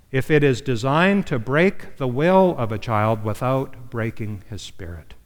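usual speaking voice

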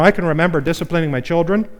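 usual speaking voice

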